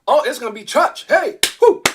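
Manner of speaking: high, loud whooping cheer; imitating people going crazy over good news; mock celebration. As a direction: hype it like the crowd going wild. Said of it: "People gonna be going crazy".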